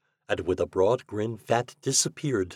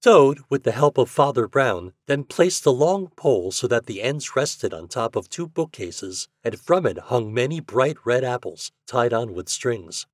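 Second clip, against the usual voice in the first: ordinary delivery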